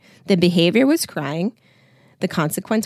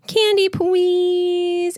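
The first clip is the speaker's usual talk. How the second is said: put-on very high, drawn-out whiny little girl; toddler whine; 12 semitones higher, 3 dB louder, much slower than usual. A narrator's reading. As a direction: stretch it slowly like a begging two-year-old. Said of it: "She begins to whine".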